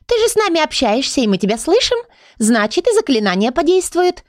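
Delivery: high-pitched, confident little book; optimistic. A narrator's reading. Little book argues the spell will work, optimistic. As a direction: reassure her brightly in a high voice. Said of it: "оптимистично заявила она".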